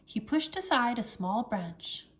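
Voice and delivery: plain reading voice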